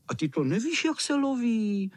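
high, mocking disbelief from the older boys; scornful. A narrator's reading. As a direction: sneer the question, pitch climbing high. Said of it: "Řekli opohržlivě ostatní". (pohrdavě, vysokým hlasem)

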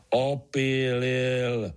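"Opílil" stretched very slowly, insisting; mild roar. A narrator's reading. Artur insists on his word to Pája, mock roaring. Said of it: "zařval Artur".

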